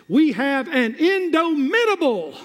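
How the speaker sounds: high, soaring build on "indomitable"; emphatic preaching. Real, not acted. Preacher giving the church a key word, forceful proclamation. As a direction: drive the pitch up to proclaim "indomitable".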